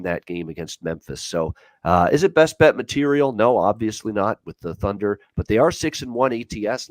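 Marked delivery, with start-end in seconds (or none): none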